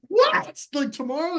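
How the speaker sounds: squeaky high incredulous question; shocked disbelief. Retold or imitated. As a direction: sound floored by the news. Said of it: "I'm like".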